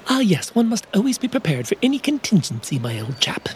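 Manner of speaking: put-on genteel old professor. Professor speaks to Bobo.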